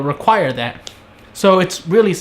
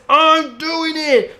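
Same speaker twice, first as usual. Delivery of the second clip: much higher, drawn-out, slow whiny insistence; childish mock whine; 12 semitones higher, much slower than usual. Iris sulkily insists to Barry, high mock whine. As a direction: stretch it out like a sulky kid.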